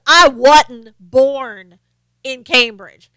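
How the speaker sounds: loud, very drawn-out Southern drawl; exaggerated slow Southern accent; mock Southern accent. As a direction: mimic a politician's fake Southern twang, stretching each word. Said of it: "get on a Southern accent".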